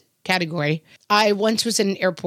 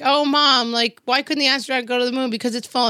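put-on kid telling a joke; 6 semitones higher than usual. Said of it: "They're like".

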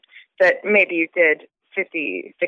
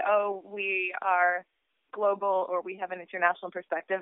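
mock quote; 9 dB quieter than usual. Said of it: "A lot of places say".

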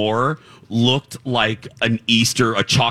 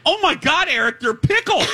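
much higher, a delighted shriek about pickles; excited squeal. Shown as a demonstration. Host re-enacting her reaction to pickles for a co-host, squealing with delight. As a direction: gush over the pickles like it's thrilling news. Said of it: "What did you say again?".

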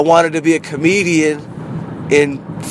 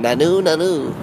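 Mork impression; 2 semitones higher than usual. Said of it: "imitated Mork from Ork".

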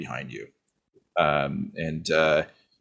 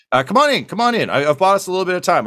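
higher and louder, quick and eager; eager invitation; 11 semitones higher, 5 dB louder, faster than usual. Acted. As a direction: hurry them inside with a show of friendliness. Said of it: "he says".

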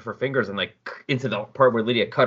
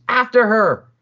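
barked order, much higher and louder; yelled order; 11 semitones higher, 7 dB louder, slower than usual. Acted. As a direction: shout the soldiers into chase. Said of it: "Laurel starts yelling at the soldiers".

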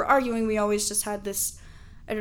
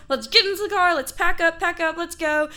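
higher, louder urgent mom; freaking out; 7 semitones higher, 4 dB louder than usual. Retold or imitated. Mom rushing everyone to the car, freaking out. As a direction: imitate a frantic mom hurrying everyone. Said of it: "she's all freaking out".

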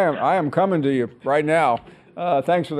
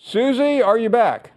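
raised, high call into the line; 8 semitones higher, 4 dB louder than usual. Real, not acted. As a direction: call out to check they're there. Host calling out to lost caller.

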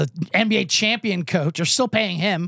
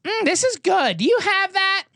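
put-on high, ditzy drunk girl voice; mock a tipsy girl at the bar; 12 semitones higher than usual. Mocking drunk girls at a dive bar, high ditzy voice. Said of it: "they're like".

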